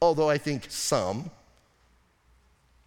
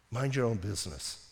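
"Mind your own business" soft and low, almost to yourself; quiet aside; 7 semitones lower, 7 dB quieter than usual. Shown as a demonstration. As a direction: drop it down to a murmur. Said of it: "repeated as a refrain".

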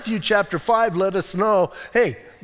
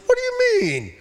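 high, squeaky indignant whine; mock-innocent whining protest; whiny protest. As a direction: play the offended innocent, voice shooting up. Israelites protesting God's accusation.

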